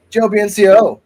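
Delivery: high, loud name call; announcer call. Acted.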